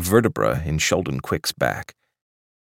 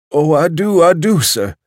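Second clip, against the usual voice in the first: put-on much higher, gentle old waiter voice; a doddering old waiter offering more; sweet old waiter; 11 semitones higher, 8 dB louder than usual. Waiter wishing him luck.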